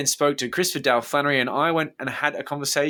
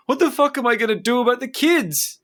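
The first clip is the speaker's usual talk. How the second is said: much higher, louder voice close to tears; tearful outburst; 11 semitones higher, 4 dB louder than usual. Retold or imitated. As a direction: cry out for the children's sake. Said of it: "Flannery started to cry".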